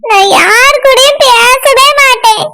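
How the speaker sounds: slow sulky crying; tearful. Acted. Sulking friend refuses to talk to anyone, tearful. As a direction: drag each word out in a weepy whine. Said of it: "இப்படி உட்கார்ந்து அழுதுட்டு இருக்க".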